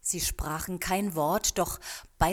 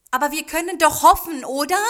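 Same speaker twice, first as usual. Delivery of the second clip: high, loud, quick anxious question; worried; 10 semitones higher, 11 dB louder, faster than usual. A narrator's reading. Yumiko asks the old man if they may hope, worried. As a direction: press the worry upward, rising on the last word. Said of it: "fragte Yumiko besorgt".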